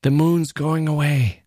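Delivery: high, drawn-out cry of alarm; stretched, high-pitched outcry. A narrator's reading. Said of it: "came a cry".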